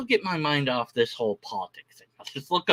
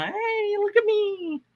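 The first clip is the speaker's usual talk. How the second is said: very high, mock eager squeak; a hammed-up little plea for attention; mock eager commenter; slower than usual. Stream viewer begging chat to notice, very high mock plea. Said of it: "you know".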